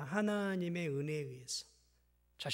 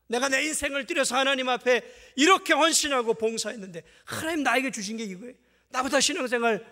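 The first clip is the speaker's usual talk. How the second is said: high, loud indignant protest to God; aggrieved complaint; 7 semitones higher, 10 dB louder than usual. Retold or imitated. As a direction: demand it like a believer who feels cheated.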